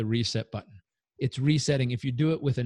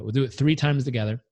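loud coaching breath instructions; louder, pushing the group through each step; guided breathing; 6 dB louder than usual. Shown as a demonstration. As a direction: lead the breathing drill with energy. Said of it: "Here's how we'll do it".

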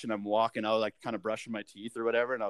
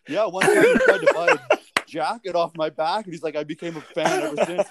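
mock fan voice; 7 semitones higher, 9 dB louder than usual. Fan retelling to buddies.

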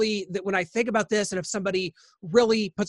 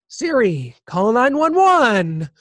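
slow, drawn-out phone command; mock-bored call to a voice assistant; casual, almost annoyed; 4 dB louder, much slower than usual. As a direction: imitate mild annoyance, stretching the words. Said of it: "just almost annoyed instead of horrified".

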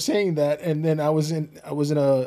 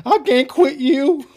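high, drawn-out melodramatic declaration; mock lovelorn; 8 semitones higher, 4 dB louder, slower than usual. Wife teasing spouse who over-sends reels, mock lovelorn. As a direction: stretch it out high and slow, half laughing.

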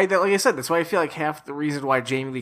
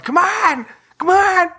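high shrieking yell; frantic yell; 7 dB louder, slower than usual. Retold or imitated. Imitating character yelling at driver.

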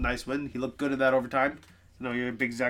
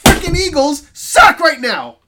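much higher, much louder and slow wail; anguished rant; 14 dB louder, slower than usual. Real, not acted. Eagles fan venting about his losing team, anguished yell. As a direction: howl it like a suffering fan.

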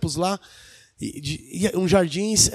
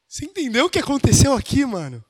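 ordinary talk

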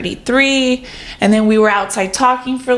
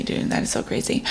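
whisper; 10 dB quieter, faster than usual. Real, not acted. Repeats it under breath.

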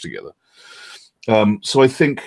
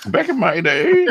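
mock old-timer; 11 semitones higher, slower than usual. Echoing host, mock old-timer.

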